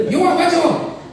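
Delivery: high-pitched mock takeout counter voice; restaurant worker. Worker asking a teriyaki customer about rice, high put-on voice. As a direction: push the order question out in a high caricature. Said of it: "when you go to them".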